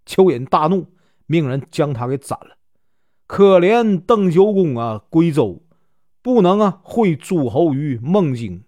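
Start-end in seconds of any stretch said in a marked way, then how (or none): none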